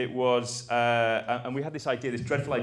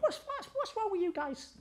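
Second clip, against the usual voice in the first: much higher, softer imitation of Danny; Danny's way of talking; 7 dB quieter than usual. Account man asking the stuck team, high imitated voice. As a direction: do Danny's odd lilting voice. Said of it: "That's kind of how he spoke".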